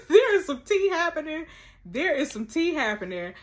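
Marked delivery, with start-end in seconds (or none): 0.1-1.5 high, loud, excited announcement; gleeful gossip. Real, not acted. Telling viewers about the juicy drama, high excited glee. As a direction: burst it out after the laugh.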